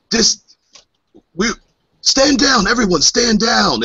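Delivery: high, urgent shout to the crew; shouted order. Acted. Captain yelling at his crew to stand down, high and urgent. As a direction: bark it to halt everyone.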